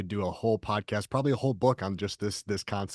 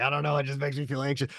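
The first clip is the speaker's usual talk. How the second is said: higher, put-on voice of a nervous person; anxious excuse-maker; 7 semitones higher, 4 dB louder than usual. Host mimicking a hesitant caller, higher put-on voice. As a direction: imitate someone talking themselves out of it. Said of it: "It's just like".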